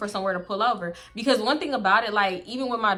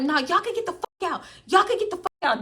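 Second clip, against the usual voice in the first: higher mock yell; 9 semitones higher than usual. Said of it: "I'm like".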